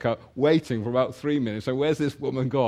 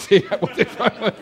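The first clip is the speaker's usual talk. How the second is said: laughing; 6 semitones higher, 5 dB louder than usual. Real, not acted. Laughing while telling son.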